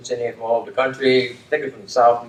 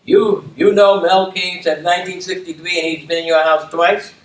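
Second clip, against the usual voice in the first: normal talking voice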